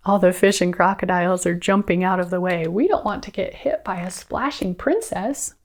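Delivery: plain reading voice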